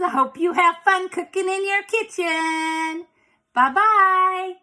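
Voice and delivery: high, playful bee voice. Pretend. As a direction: stay in the little bee character. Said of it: "keep pretending like I'm a bee".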